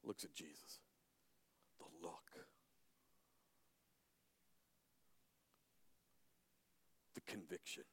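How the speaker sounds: very soft, sunken murmur; quietly describing the look of Jesus; hushed awe. A narrator's reading. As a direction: drop to almost nothing. Dramatic drop after climax.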